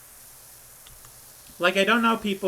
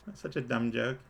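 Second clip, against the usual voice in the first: ordinary talk, nothing marked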